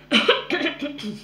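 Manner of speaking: dry cough; raspy throat cough. Real, not acted. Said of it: "j'ai la voix qui part en cacahuète".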